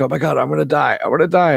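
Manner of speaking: high, rising, hammed-up panic; mock panic. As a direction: play it as a hammy allergy freak-out. Josh's allergic reaction to nuts.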